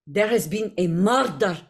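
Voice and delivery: game character's Slavic accent, each word separate; Jaheira. Shown as a demonstration. Actor comparing accents for host, in Slavic accent. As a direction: say "murder" with Slavic rolled r's. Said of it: "to say it in a Jaheira".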